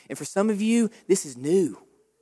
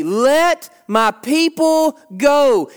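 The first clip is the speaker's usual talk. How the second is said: slow, raised, deliberate command; Moses' demand; 6 semitones higher, 4 dB louder, much slower than usual. Retold or imitated. Moses demanding freedom from Pharaoh, slow and raised. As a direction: lay down each word to the king. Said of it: "what does he say".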